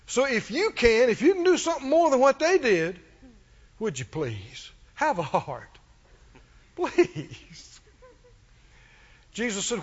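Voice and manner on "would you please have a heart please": softly imitated begging; a hushed, hammed-up plea, drawing out please; pleading. Preacher imitating a desperate father begging Jesus, pleading. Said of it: "he's pleading".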